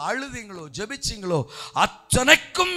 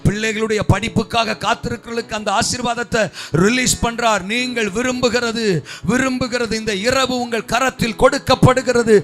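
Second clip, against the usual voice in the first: louder and louder declaring blessings; preaching at full force; 9 dB louder than usual. Acted. Pastor declaring blessings over the waiting congregation, building loud proclamation.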